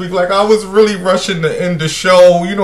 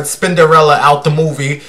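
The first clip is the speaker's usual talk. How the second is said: ordinary talk, nothing marked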